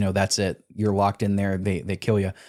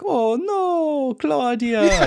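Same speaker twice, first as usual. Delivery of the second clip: high squeaky cartoon dismay, laughing through it; Oscar Kokoschka voice; 3 dB louder, much slower than usual. Host mimicking the game character's squeaky panic. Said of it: "He sounds just like Oscar Kokoschka".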